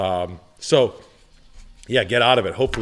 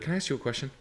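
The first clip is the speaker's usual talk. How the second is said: soft, slightly higher confidential tone; discreet polite question; 4 semitones higher, 10 dB quieter, much faster than usual. Retold or imitated. Salesman retelling his ask to an old couple, soft and polite. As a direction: ask it gently, setting up a tease.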